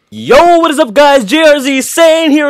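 high, loud excited greeting; hyped intro. Real, not acted. Youtuber greets his viewers, high and loud with excitement. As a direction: hype the viewers up as the show opens.

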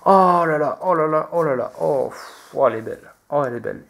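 stunned, repeated oh là là; amazed. Real, not acted. YouTuber marvelling at a rare card he just pulled, amazed. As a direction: gasp in wonder at a lucky find.

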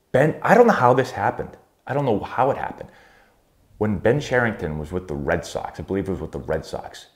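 ordinary talk, nothing marked

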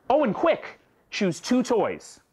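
put-on high, hurried call to the child; mother rushing her son to pack; urgent mother. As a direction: hurry him along. Mother voiced urging child.

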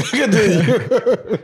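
slow, laughing "Continue". Real, not acted. Host urging the guest on while laughing. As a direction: stretch it out through laughter. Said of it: "repeats laughing".

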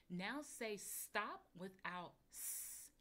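slow, deliberate instruction. Shown as a demonstration. Teacher slowly giving the sound-deletion task. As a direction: spell out each step slowly.

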